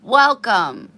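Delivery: loud bright welcome; big happy greeting; enthusiastic welcome. Real, not acted. Greeting new viewers warmly.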